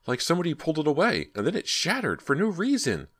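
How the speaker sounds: high, fast, breathless rush; frantic crying out. A narrator's reading. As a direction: tumble the words out in panic. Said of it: "Ginny cried".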